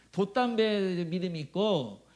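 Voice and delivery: ordinary delivery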